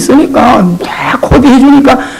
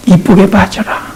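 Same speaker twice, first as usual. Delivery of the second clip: normal talking voice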